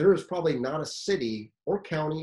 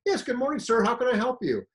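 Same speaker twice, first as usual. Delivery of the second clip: higher, sing-song mock-polite greeting; sarcastic politeness; 9 semitones higher than usual. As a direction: lay the fake courtesy on thick. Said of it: "totally a sarcastic response".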